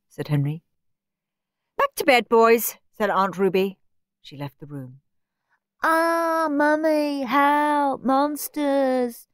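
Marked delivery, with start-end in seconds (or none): 1.8-2.8 higher, louder aunt giving orders; brisk order. A narrator's reading. Aunt Ruby to the boys, brisk order. 5.8-9.3 high, drawn-out fake cry; mocking mimicry. A narrator's reading. Henry mimics Steve's screams, mocking. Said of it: "mimicked Henry".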